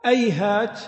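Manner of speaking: أيهات with the h turned to a hamza, ayhāt; أيهات opening on a glottal catch; glottal stop first. Shown as a demonstration. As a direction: start أيهات with a hamza, not h. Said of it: "بقلب الهاء همزة".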